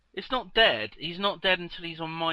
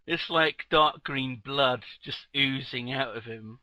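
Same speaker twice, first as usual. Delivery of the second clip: ordinary talk, nothing marked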